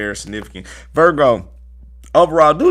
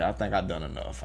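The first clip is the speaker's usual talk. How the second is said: quieter, lower; 6 semitones lower, 10 dB quieter than usual. Shown as a demonstration. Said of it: "first take, lower and softer".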